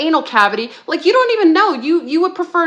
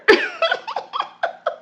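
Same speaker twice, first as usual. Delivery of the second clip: a long laugh rising way up; delighted high-pitched laughter; 3 dB quieter than usual. Real, not acted. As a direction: squeal with laughter at her own line. Laughing after slogan.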